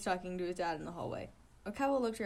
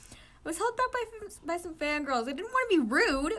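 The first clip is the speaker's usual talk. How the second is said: whiny excuse; 9 semitones higher, 7 dB louder than usual. Acted. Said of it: "Oikawa explained".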